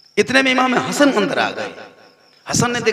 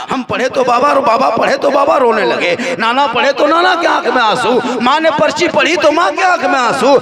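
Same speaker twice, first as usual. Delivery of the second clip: grows higher and louder line by line; building lament; 6 semitones higher, 4 dB louder than usual. Acted. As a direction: pile up the grief. Preaching at full force.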